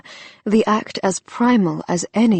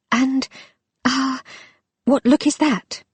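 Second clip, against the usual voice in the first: high, breathless question; 7 semitones higher than usual. Acted. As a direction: ask it out of breath. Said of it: "She felt breathless".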